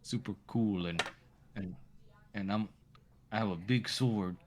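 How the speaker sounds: a lightly voiced cool swordsman; a kid mimicking a self-important hero; mock Falmouth. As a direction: kid lightly mocking a bragging warrior. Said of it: "Falmouth sounds like this".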